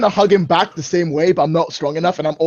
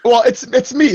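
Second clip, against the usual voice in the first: ordinary delivery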